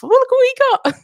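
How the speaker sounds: put-on high, loud, gleeful child's squeal; excited kids. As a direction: imitate a kid showing off a treat on camera. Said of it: "their kids take over the stream".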